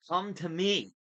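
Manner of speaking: high, loud desperate plea. A narrator's reading. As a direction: plead urgently for a friend to come. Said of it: "I thought desperately".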